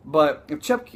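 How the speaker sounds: usual speaking voice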